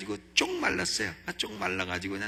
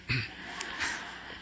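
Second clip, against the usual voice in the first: laugh; 4 semitones lower, 7 dB quieter than usual. Real, not acted. Laughs at own story.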